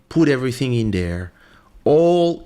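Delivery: normal talking voice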